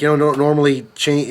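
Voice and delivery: ordinary talk, nothing marked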